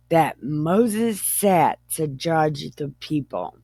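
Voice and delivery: usual speaking voice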